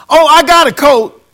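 mock excuse voice. Mocking excuses of unprepared believers.

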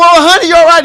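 high, loud check on his wife; retold startled "are you okay"; alarmed concern, retold. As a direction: call out worriedly, acting out the moment. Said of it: "and I come".

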